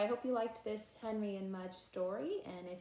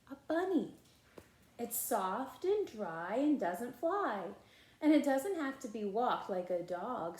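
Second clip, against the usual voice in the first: put-on lively read-aloud voice for children; animated storybook reading with character lines; story narration; 7 dB louder than usual. A narrator's reading. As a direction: read it like a bedtime picture book. Reading picture book aloud.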